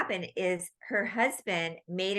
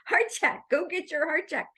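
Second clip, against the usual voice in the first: higher, urging, repeated nag; my insistent voice; 9 semitones higher than usual. Retold or imitated. The medium's voice nagging the sleepless woman, higher and insistent. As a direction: call it out like an echo in her head. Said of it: "she kept hearing my voice, [name], saying".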